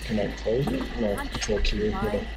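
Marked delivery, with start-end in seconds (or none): none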